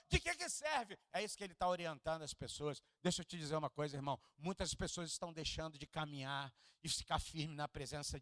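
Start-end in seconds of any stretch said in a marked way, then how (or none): none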